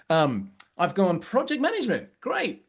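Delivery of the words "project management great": much higher, over-the-top fake enthusiasm; telling the group how he faked excitement at Jeff's job; mock enthusiasm. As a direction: chirp it like you are pretending to care. Mocking his own fake enthusiasm.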